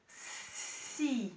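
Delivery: ordinary delivery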